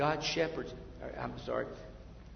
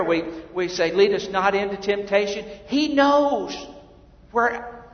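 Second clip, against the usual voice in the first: louder, higher, fervent preacher; preaching at full force; 6 semitones higher, 13 dB louder than usual. Acted. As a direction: drive each line home to the congregation.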